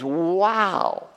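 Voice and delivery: wow stretched slowly with a falling tune; slow, wondering wow; slow drawn-out wow. Shown as a demonstration. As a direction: let the word wow hang in awe. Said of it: "It's a comparative".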